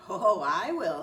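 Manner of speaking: loud, eager yes; eager shout. Acted. Goose eagerly claims the fresh bread, loud. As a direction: shout it out greedily.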